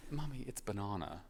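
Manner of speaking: soft British correction, "banana" with long AH; quiet Oxford English, amused; posh British child. Retold or imitated. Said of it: "astoundingly British accent, like, it's proper Oxford English".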